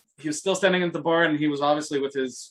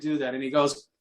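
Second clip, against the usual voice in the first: usual speaking voice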